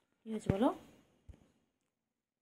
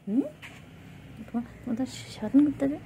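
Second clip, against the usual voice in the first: fast, higher, louder mock scold; 7 semitones higher, 4 dB louder, much faster than usual. Real, not acted. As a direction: tease the pet with a quick scolding. (রাগের ভান করে দ্রুত বকুনি)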